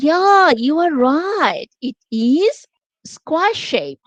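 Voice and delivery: slow soothing teacher voice; slow soothing intonation. Shown as a demonstration. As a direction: stretch each word to soothe the child. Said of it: "it was slow, it was clear".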